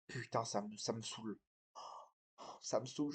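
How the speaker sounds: quiet exasperated mutter; fed-up grumble. Acted. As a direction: grumble to yourself, worn out. Acted quarrel, fed-up grumble.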